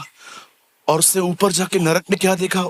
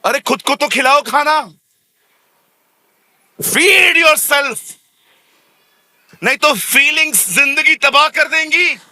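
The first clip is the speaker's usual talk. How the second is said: louder, higher, urgent exhortation; forceful preaching; 8 semitones higher, 5 dB louder than usual. Real, not acted. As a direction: hammer the point home to the crowd.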